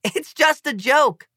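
put-on boy pleads with delegates, slowly; pleading. A narrator's reading. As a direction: beg them to see the joke. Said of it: "I plead".

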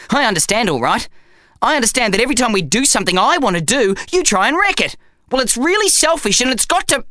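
high, fast indignant rant; angry rant. A narrator's reading. Zach ranting at his selfish bum, fast and indignant. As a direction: let it pour out in a fast huff.

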